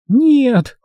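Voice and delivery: high, loud, desperate no; hoarse cry. A narrator's reading. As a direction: cry out to stop him in a high woman's voice. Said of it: "осипшим голосом сказала Эдвина".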